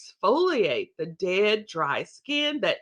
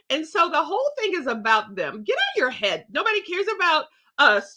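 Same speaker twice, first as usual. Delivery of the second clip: ordinary talk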